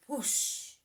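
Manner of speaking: breathy whoosh of rushing water; whoosh sound. A narrator's reading. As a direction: push air out like a gushing hose. Water gushes from hose.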